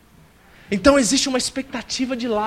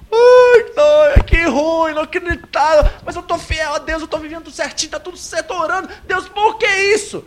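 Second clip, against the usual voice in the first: high whiny mock lament; complaining believer; 8 semitones higher, 4 dB louder than usual. Sufferer complaining to God in a high mock whine. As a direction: whine to God, pitched well up, half-joking. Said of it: "Primeiro é que vai vir a aprovação".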